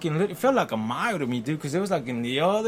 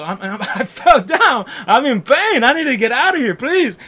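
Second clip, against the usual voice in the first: loud, high pained plea; loud mock plea in pain; pleading; 9 semitones higher, 9 dB louder than usual. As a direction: beg to be let out, hurting. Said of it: "I go".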